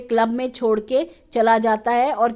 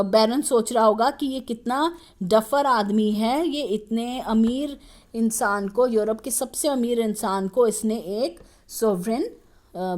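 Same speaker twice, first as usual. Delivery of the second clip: ordinary delivery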